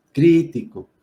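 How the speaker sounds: crítico with CRÍ pitched high; stress on first syllable. Shown as a demonstration. Teacher confirming the accented syllable, crítico stressed on CRÍ. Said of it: "antepenúltima sílaba".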